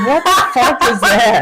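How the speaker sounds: loud laughing outburst; laughing shout. Real, not acted.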